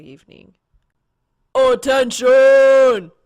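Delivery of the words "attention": slow, drawn-out loud call across the room; announcing shout. A narrator's reading. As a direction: bellow it to the whole diner. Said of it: "Blake announces to the entire diner".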